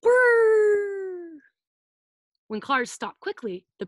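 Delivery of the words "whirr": high loud long screeching "whirr"; tire squeal. Shown as a demonstration. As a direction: squeal "whirr" like tires round a corner. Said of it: "their tires go whirr".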